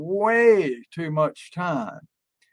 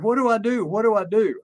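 a higher, mildly panicked retold plea; frantic quote; 5 semitones higher, faster than usual. Retelling a hypnotherapist panicking over fleas, mock frantic. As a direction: imitate a frantic client, lightly. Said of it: "she was frantic".

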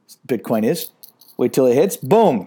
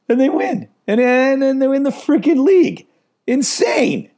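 excited; 12 semitones higher than usual. Real, not acted. Excitement over Liverpool winning.

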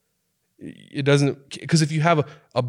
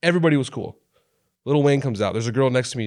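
ordinary talk, nothing marked

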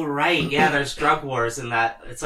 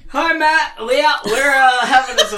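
much higher, louder, laughing field reporter voice; hammed-up reporter shouting over a storm; mock weather reporter; 11 semitones higher, 6 dB louder than usual. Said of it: "can you throw it to the weather for me?".

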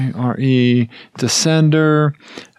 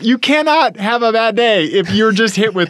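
normal talking voice

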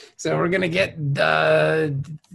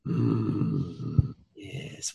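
mock sultry whisper; 8 dB quieter than usual. Continues sultry bit.

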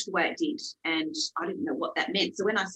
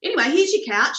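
high, airy thanks, then louder dismissal; awkward brisk thanks; 11 semitones higher, 4 dB louder than usual. Retold or imitated. Seller thanking the buyer for a compliment, awkwardly polite. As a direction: imitate polite thanks and move him along.

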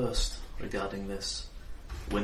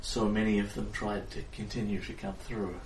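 tired distant fairy queen; 5 dB quieter than usual. Acted. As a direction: speak as a weary queen drifting off, far away. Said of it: "She sounds very tired and very far away".

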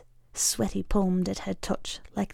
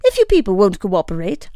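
loud, quick and huffy; huffing; 7 dB louder, faster than usual. Acted. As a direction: huff it out in irritation. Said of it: "huffs the manager".

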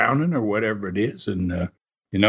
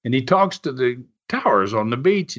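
ordinary delivery

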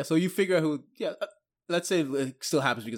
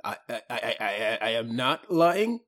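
nervous stammering; 4 semitones lower than usual. Acted. Little one denying a lie to the soldier, nervous stammer. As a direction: stammer it out, meek and scared.

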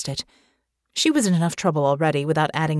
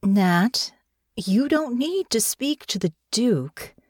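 slow, careful and soothing, higher; coaxing her gently, as if she's fragile; said slowly; 5 semitones higher, slower than usual. A narrator's reading. Irene soothing a battered Natasha, slow and careful. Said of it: "she said slowly".

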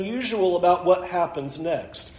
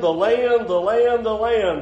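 each "the land" hit with a raised, falling stress; emphatic repetition; 3 semitones higher than usual. Shown as a demonstration. Preacher drilling the land theme into his congregation, emphatic. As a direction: hammer "land" home three times, higher each time. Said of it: "this emphasis of the land".

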